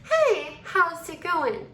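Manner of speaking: slow greeting, long S in "how's"; emphasis on the S. Shown as a demonstration. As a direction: linger on the S of "how's". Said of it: "do you hear that emphasis on the S".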